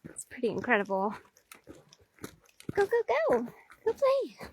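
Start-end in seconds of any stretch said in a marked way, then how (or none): 2.7-4.5 high, soft sing-out to dogs; high-pitched dog-talk encouragement; urging dogs on. Real, not acted. Shooing dogs off to play.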